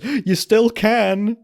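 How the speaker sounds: high, loud, drawn-out retort; mock pleading. Frasier retold trying his luck after being kicked out, mock pleading. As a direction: stretch it out in a high mock plea. Retelling Frasier's hopeful plea.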